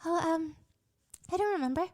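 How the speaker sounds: hint at her uncertainty, gently; vague teen girl. Retold or imitated. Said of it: "They ask her".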